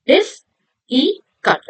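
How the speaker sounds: 'difficult' drawn out in three slow beats; syllable by syllable. Shown as a demonstration. Teacher asking children how many syllables, syllable by syllable. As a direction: pause between each part of 'difficult'. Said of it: "How many syllables?".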